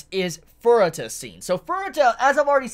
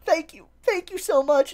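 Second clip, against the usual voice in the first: mock shaky thanks; 11 semitones higher than usual. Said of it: "This man just shaking".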